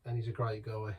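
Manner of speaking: ordinary talk, nothing marked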